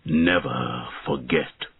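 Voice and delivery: slow, low and grave dragon voice; an ancient dragon laying down a vow; solemn dragon. Acted. Dragon's last words to knight.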